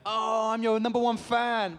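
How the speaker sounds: high, loud, drawn-out mock fan gushing; hammed-up starstruck squeal. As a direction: imitate an overexcited fan. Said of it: "they're like".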